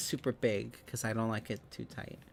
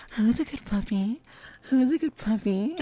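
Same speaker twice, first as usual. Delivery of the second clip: high, loud doting puppy talk; Lilac impression; 9 semitones higher, 5 dB louder than usual. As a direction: coo at a good puppy. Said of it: "That was my lilac impression".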